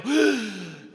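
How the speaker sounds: sharp, audible gasp; big gasp for air. Retold or imitated. Swimmer reaching the surface out of air, big gasp. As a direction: suck in air as if breaking the surface. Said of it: "just as I go".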